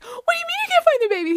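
acted fast, very high squeal; panicked disbelief. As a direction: fire back in mock panic, way up high. Wife panicking in acted quarrel.

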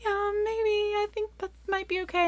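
high, hesitant approval; tentative okay. Retold or imitated. Mom answering guests about their signs, tentative. Mother unsure, reassures guests.

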